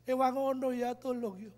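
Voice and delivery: soft, pleading prayer, imitating his past crying; retold tearful plea. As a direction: beg God quietly, half-sobbing in memory. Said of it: "saya malam jam enam nangis".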